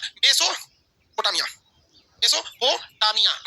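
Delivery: slow drill of Mesopotamia; drawn out. Shown as a demonstration. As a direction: stretch every syllable of Mesopotamia very slowly. Teacher repeats the answer slowly.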